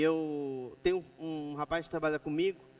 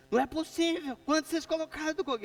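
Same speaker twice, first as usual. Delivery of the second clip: fast, higher, angry shout at the workers; retold angry outburst; 9 semitones higher, 4 dB louder, faster than usual. Boss retelling yelling at workers over concrete, angry. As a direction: blow up at them over the weak concrete. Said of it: "eu surtei".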